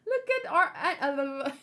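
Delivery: can't stop laughing at the picture; real laughter bubbling up. Real, not acted. Giggling at a funny drawn face.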